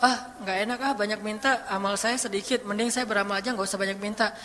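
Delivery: soft, low reluctant murmur; acted diffident aside; reluctant self-talk. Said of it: "Jangan suuzon sama Allah terus bilang".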